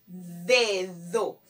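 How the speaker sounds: DEDO slowly, with each syllable stretched; drawn-out syllables. Shown as a demonstration. Teacher modelling a word for spelling, "Dedo" slowly sounded out. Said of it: "Vamos primero con el sonido inicial".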